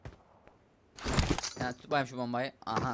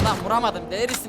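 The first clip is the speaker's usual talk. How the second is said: exasperated yell; 7 semitones higher, 5 dB louder than usual. Real, not acted. Misses enemy again.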